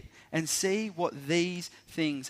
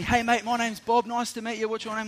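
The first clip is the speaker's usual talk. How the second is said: higher-pitched, hearty hello to a newcomer; eager camp greeter; 6 semitones higher than usual. Retold or imitated. Camp worker Bob greets the arriving teacher, eager and high. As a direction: play the over-friendly camp host.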